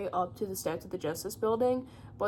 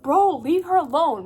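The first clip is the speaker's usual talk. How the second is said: louder, higher defiant call; protective shout; 5 semitones higher, 7 dB louder than usual. Retold or imitated. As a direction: shout them off her. Girl shields grieving mother.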